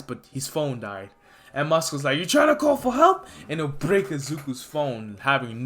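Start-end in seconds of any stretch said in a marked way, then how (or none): 2.2-3.3 high, loud sneer; mocking taunt. Villain mocking Izuku's call for help, high and loud. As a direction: taunt him like a bully.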